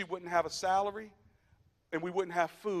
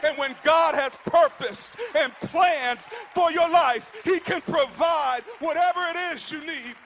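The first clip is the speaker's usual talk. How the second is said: builds from loud and higher to a shouted, much higher, slowed preach; preaching climax; 6 semitones higher, 10 dB louder than usual. Acted. Pastor driving home God provides to the congregation, building shouted preach. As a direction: climb the ladder, every line hammering harder.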